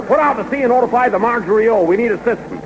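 fast, high, eager commands; excited orders. Acted. Boss eagerly ordering the skipper back out to sea, fast and high. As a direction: rattle off the orders in a giddy rush.

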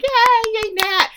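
high, loud mock cheer; cheering girls. The girls cheering Nat's shot, high mock cheer. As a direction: cheer her on, squealing. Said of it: "all the girls are like".

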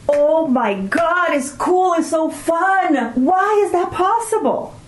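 high, slow, gushing imitation of her son; excited teen son. Son raving to mom about school, high slow gushing imitation. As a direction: gush like a thrilled teenager who can't believe it. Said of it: "his reaction was exactly like this".

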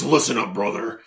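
gruff Hulk Hogan promo voice; wrestling promo. Retold or imitated. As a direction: growl it like a wrestler cutting a promo. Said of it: "cutting a promo and he says".

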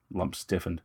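plain reading voice

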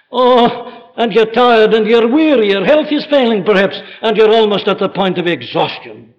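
impassioned preaching. Acted. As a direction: lift the voice in fervent appeal. Preacher builds to impassioned cry.

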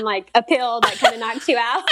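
ordinary delivery

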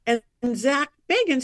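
usual speaking voice

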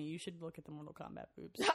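ordinary talk

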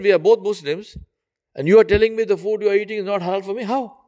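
high, indignant disbelief; man protesting to his neighbour, high and incredulous. Acted. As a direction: pitch it up in outraged disbelief.

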